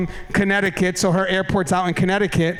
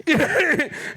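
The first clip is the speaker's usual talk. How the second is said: high burst of laughter; real laugh; 8 semitones higher than usual. Real, not acted. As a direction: laugh it off, amused at the trip. Laughs at the long drive.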